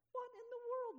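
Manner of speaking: high, loud, astonished cry; incredulous outburst. Real, not acted. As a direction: shoot up in disbelief.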